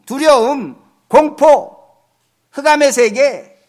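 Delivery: loud, slow, rising sermon cadence; stretched-out, swelling pulpit delivery; fervent preaching. Acted. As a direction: hammer each phrase home from the pulpit. Preaching climax, listing human limits.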